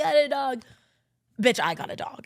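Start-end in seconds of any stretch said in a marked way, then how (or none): 0.0-0.6 imitating boyfriend. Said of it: "which I knew when he called me".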